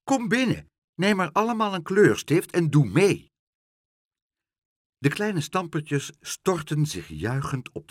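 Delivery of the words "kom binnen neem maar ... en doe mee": high, loud, lively invitation; calling out. A narrator's reading. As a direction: call everyone in to join the fun. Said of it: "riep ze".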